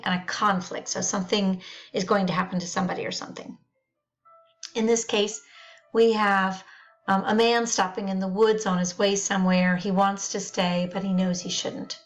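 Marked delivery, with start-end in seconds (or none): none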